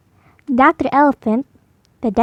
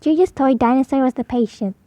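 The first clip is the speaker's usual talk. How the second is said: plain reading voice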